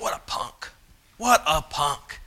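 disapproving thought, muttered slowly; muttered disdain. Retold or imitated.

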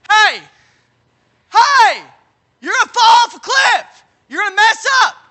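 loud, high calls of alarm; shouted warning. Acted. Preacher as the Holy Spirit warning of a fall, high urgent shout. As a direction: shout to stop someone in danger.

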